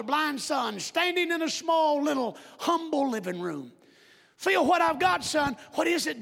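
high, loud acted family scene; high, loud voices of a blind father and son. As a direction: play a proud father giving his son a gift.

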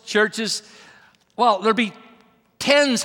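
ordinary delivery

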